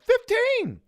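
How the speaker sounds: very high, loud yell; yelled number. Retold or imitated. Inmate yelling a joke number across the prison, high loud yell. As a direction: bellow it down the cell block. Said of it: "someone yells out".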